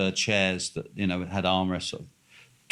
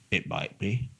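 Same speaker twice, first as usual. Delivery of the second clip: softer, guarded mock-posh answer; curt, unfriendly reply; 3 semitones lower, 7 dB quieter than usual. Bar owner answering a tourist's question, not openly friendly. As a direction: imitate a man who isn't openly friendly. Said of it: "Like that".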